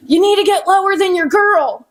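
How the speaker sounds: high-pitched mock sideline call; dad coaching from bleachers. Daughter imitating dad coaching her at basketball games, mock call from the bleachers. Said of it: "in the bleachers telling me".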